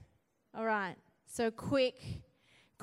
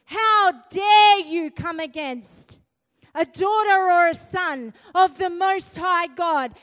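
fiery preaching; 4 semitones higher, 7 dB louder than usual. Acted. Preacher urging the church against the enemy, loud and fierce. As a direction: roar it at the enemy.

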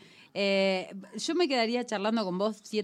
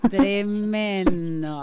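slow, drawn-out, louder awe; awed; 2 semitones higher, 7 dB louder, much slower than usual. Real, not acted. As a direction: let the word sink in, stunned by the poem. Reacción asombrada tras el poema. (asombro real, "tremendo" estirado y lento)

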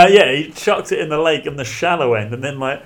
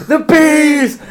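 high, loud, drawn-out panicked shriek; Nicolas Cage screaming; 11 semitones higher, 8 dB louder, much slower than usual. Retold or imitated. Friends mocking a film death, high loud hammed-up shriek. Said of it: "Nicolas Cage in The Wicker Man, where he's like".

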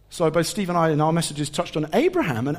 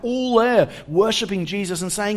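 ordinary talk, nothing marked

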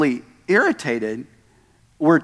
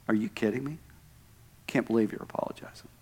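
much softer, half-whispered disbelief; mock scornful reply; 5 semitones lower, 12 dB quieter, faster than usual. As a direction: scoff under your breath at the apology. Mocking someone refusing an apology.

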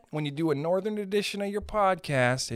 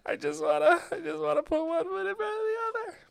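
high, strained pleading voice, much higher and a bit fast; addict voice; 13 semitones higher than usual. Retold or imitated. Ice cream addict at the creamery, high strained plea. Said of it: "you're like".

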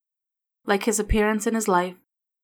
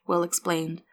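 ordinary delivery, nothing marked

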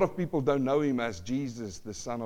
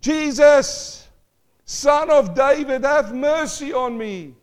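much higher and louder, slow desperate cry; 9 semitones higher, 7 dB louder, slower than usual. Acted. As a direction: cry out even harder than before the crowd. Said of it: "he cried out aloud more".

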